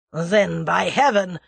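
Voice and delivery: loud Russian snarl; angry, menacing outburst; snarled threat. A narrator's reading. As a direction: snarl it as an oath. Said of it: "snarled the Russian".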